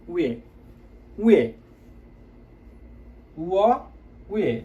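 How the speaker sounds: উয়ে and উয়া repeated distinctly; vowel drill. Shown as a demonstration. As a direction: drill the syllables for the class. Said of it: "কি হয়ে গেল উচ্চারণটা". (উচ্চারণ অনুশীলন)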